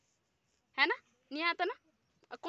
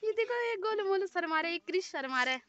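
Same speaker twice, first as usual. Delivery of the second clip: high, louder playful teasing; 7 semitones higher, 7 dB louder than usual. Real, not acted. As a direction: tease him for being shy. Teasing a shy boy.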